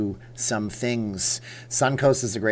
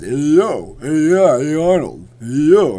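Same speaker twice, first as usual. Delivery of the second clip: loud slurred movie tough-guy impression; calling out in a hammy action-star voice; big, goofy celebrity impression, loud; Sylvester Stallone; 4 semitones higher, 10 dB louder, slower than usual. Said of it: "Sylvester Stallone just goes".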